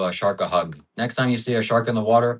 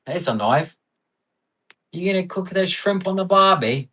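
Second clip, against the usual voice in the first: loud, high-pitched hammed-up Australian accent; Aussie accent; 9 semitones higher, 4 dB louder than usual. Streamer performs on request for a donor, hammed-up Aussie accent. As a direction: do a broad, excitable mock-Aussie voice. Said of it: "Give us your best Aussie accent".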